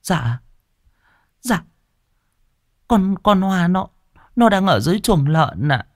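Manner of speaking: high, trembling servant near tears; old cook whimpering to her mistress. A narrator's reading. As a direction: speak on the verge of crying. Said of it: "Bà mếu máo".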